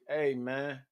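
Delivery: soft, low actor impression; Tay Diggs. Shown as a demonstration. Comic demonstrating his Tay Diggs voice, low and soft. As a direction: drop it deep and quiet for the impression. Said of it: "my Tay Diggs voice".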